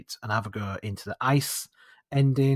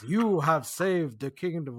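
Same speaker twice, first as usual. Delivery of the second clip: slow, higher mock wizard proclamation; snow wizard voice; 4 semitones higher, slower than usual. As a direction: play the grand wizard, slightly higher and drawn out. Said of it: "he goes".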